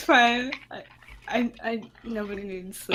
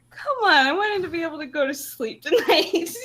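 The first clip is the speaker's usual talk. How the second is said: high-pitched plea breaking into laughter; laughing too hard to complain properly; laughing protest; 8 semitones higher, 5 dB louder than usual. Real, not acted. Laughing protest at unsettling display.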